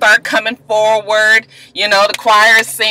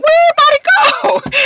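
baffled pastor. Retold or imitated. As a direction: call out to an empty choir loft. Pastor finds choir gone.

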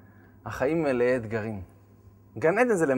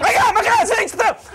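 much higher, loud, laughing; frantic shout; 9 dB louder than usual. Retold or imitated. Husband catching hot chicken from the floor, frantic. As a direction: scramble in panic. Husband grabbing spilled food.

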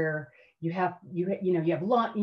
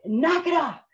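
loud, raised imitated command; sergeant's order; 10 semitones higher, 5 dB louder than usual. Drill sergeant to crying recruit, loud order to stop.

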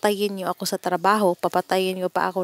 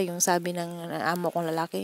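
ordinary talk

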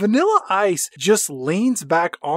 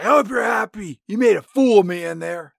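put-on loud drunken yelling; angry shout; 4 semitones higher, 5 dB louder than usual. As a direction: yell at her, furious. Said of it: "Nick is like".

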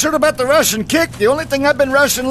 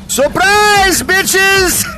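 high gleeful taunting yell; giggly tickle attack; 7 semitones higher, 3 dB louder, much slower than usual. Acted. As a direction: shriek it as you pounce. Ambush taunt again.

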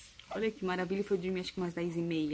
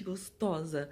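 ordinary delivery

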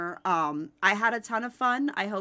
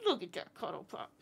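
normal talking voice

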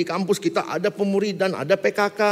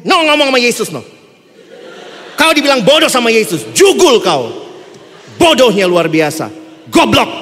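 louder, half-laughing scolding; loud laughing rebuke; 5 semitones higher, 11 dB louder than usual. Retold or imitated. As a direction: scold him, laughing at his folly. Mentor berates student.

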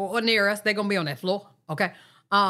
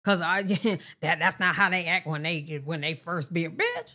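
ordinary talk

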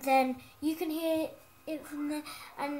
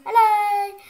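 big shouted hello, much higher and louder; 8 semitones higher, 13 dB louder than usual. Shown as a demonstration. Showing off the new mic to viewers, a loud shouted hello. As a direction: greet them at full volume into the new mic. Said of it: "So you can hear me properly now".

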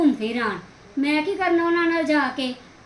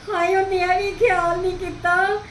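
weepy lament; 3 semitones higher than usual. Acted. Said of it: "ਰੋ ਨਾ".

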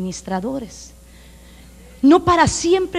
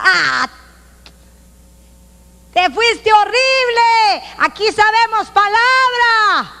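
slow, higher scoffing voice; mock scornful retort; 8 semitones higher, 4 dB louder, slower than usual. Churchgoer scoffs at a false prophecy, slow and mocking. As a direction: sneer it back slowly.

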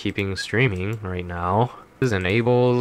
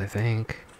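ordinary talk

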